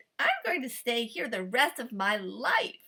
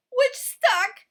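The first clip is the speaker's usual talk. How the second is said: ordinary delivery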